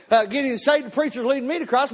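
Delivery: ordinary talk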